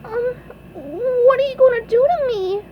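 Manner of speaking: high, wavering squeak; hesitate, then ask in a tiny cartoon voice; SpongeBob voice. A narrator's reading. SpongeBob fears the Flying Dutchman, high nervous squeak. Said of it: "asked SpongeBob".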